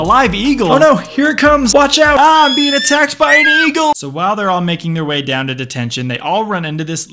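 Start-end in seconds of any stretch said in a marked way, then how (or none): 0.0-3.9 louder, higher hammed-up fright; mock panic. Narrator hamming up a pretend eagle attack, loud and high.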